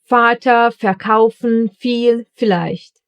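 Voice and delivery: Vater, verkaufen, viel, vielleicht each starting with an F sound; German V as F. Shown as a demonstration. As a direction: spell out each V as a clear F, slowly. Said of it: "Some other examples are".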